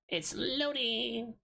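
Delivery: high, slow sing-song. Pretend. Streamer happy the page loads, high sing-song. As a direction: stretch it out happily.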